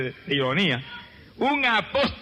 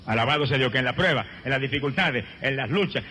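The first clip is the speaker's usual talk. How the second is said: ordinary talk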